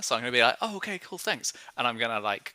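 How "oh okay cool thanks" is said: high, soft, quick-to-agree voice; small high voice, politely backing off; a little unnerved. Acted. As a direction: agree meekly, pitched up and quiet. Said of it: "I generally feel a little unnerved by her".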